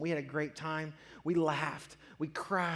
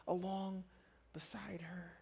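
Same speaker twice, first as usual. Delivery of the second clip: whispered ending; 9 dB quieter, slower than usual. Retold or imitated. Friend's tearful prayer retold.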